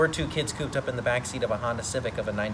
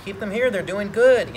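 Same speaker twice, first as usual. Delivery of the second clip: higher, louder quoted grandparents; mock urgent appeal, pitched up and loud; imitated insistent plea; 9 semitones higher, 9 dB louder than usual. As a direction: raise the voice as grandparents urging, retold. Acting out grandparents' plea to keep kids.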